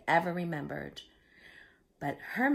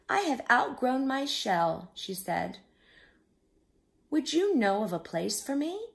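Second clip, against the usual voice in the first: higher and louder, a little crab asking for help; small crab voice; 7 semitones higher, 5 dB louder than usual. A narrator's reading. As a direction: voice a small hopeful crab, pitched up. Little crab asks for home.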